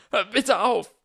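high, loud pleading scream; desperate scream. Acted. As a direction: scream for it to stop. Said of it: "schrie Harry". (verzweifelt geschrien)

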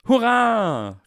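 a big rousing cheer. Acted. Soldiers cheering their general, loud hurrah. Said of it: "riefen die Sonnenscheinsoldaten".

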